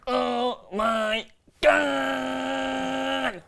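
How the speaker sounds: extremely slow, stretched-out words; drawn-out exclamation. Acted. Host building up to the quick fire round, extremely drawn-out.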